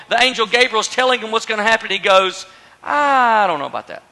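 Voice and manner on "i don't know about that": skeptical, dragging hesitation; doubtful hedging. Acted. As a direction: stretch out the opening word as if unconvinced. Zechariah doubting the angel.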